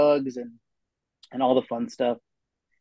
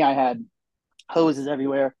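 normal talking voice

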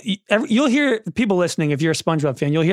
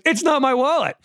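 insistent cartoon denial; 8 semitones higher than usual. Acted exasperated denial in wallet scene.

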